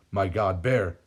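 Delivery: ordinary delivery, nothing marked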